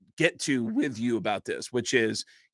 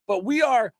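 ambush heckle; 10 semitones higher than usual. Retold or imitated. Stuttering John ambush.

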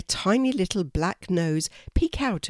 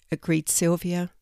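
ordinary delivery, nothing marked

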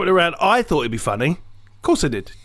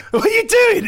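much higher, startled question; puzzled friend; 4 dB louder than usual. Retold or imitated. Friend sees the salt rifle at dinner, startled. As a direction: ask it like a baffled dinner guest. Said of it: "And they go".

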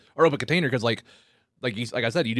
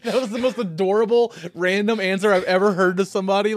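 laughing; 8 semitones higher, 4 dB louder than usual. Real, not acted. Host cracking up at answer.

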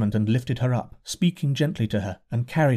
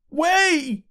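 crowd shout. A narrator's reading. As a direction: bellow at the man in the way. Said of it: "cried the men all about him".

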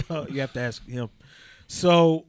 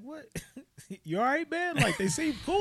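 incredulous; 13 semitones higher, 4 dB quieter than usual. Retold or imitated. Retold reply to coworker.

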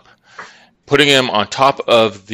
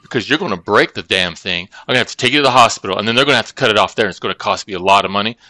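rattled-off reasoning; faster than usual. Retold or imitated. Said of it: "I said".

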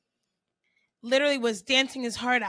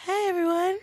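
higher-pitched performer's greeting; 6 semitones higher than usual. Retold or imitated. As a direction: imitate a pop impersonator saying hello. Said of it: "he is [name]. He goes".

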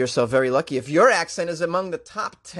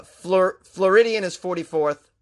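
ordinary talk

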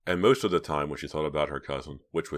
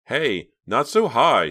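loud, slow warning call; cried out; 8 semitones higher, 10 dB louder, much slower than usual. A narrator's reading. Reva calling to her bouncing brother, loud. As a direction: call out to stop him bouncing. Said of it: "she cried".

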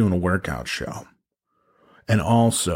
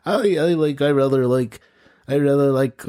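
higher, halting nerd who keeps restarting; nerdy stammer; 5 semitones higher than usual. Retold or imitated. Retelling a cooking show nerd contestant, stammering nerd voice. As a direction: stutter it out like a shy gamer nerd. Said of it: "video game nerd guy who was like".

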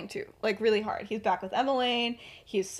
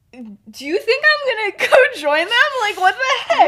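much louder, higher, laughing disbelief; incredulous outburst; 13 semitones higher, 13 dB louder than usual. Retold or imitated. Remus asking his dad if he'd join them, loud and laughing.

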